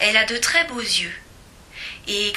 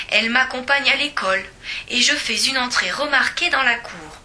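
ordinary delivery

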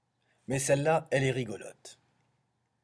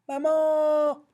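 very high, loud little-voice wail; child's cry; 7 dB louder than usual. Acted. Cry as the ogre fetches Jeannine, high loud wail.

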